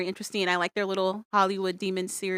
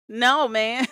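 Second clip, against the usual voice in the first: higher mock objection; imitated protest; 5 semitones higher, 4 dB louder than usual. Family pushing back at Mark, mock protest. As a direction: push back as the fed-up family.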